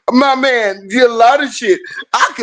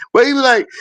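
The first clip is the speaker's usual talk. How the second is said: ordinary delivery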